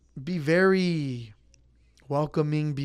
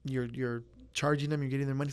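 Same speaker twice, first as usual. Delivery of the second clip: normal talking voice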